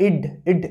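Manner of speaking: ইড as a brief stopped d, no "aw"; half-d ending. Shown as a demonstration. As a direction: demonstrate ইড with the ড cut in half. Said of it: "ডটাকে যদি অর্ধেক করে দেওয়া হয়". (ইড, অর্ধেক ড-এর উচ্চারণ)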